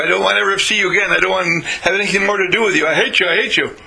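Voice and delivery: rapid, high-pitched tirade of hate; hateful outburst. Acted. Acted hateful outburst opening the talk.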